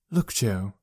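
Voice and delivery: soft, weary sigh of a mother. Acted. Mother wearily addressing her son, with a soft sigh. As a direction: breathe the words out tiredly. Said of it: "she sighed".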